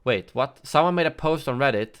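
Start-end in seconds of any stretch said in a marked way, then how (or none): none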